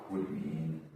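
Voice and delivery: usual speaking voice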